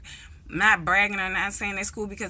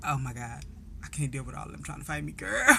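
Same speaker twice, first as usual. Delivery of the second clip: quiet dread; 4 semitones lower, 9 dB quieter, faster than usual. Retold or imitated. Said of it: "I'm like".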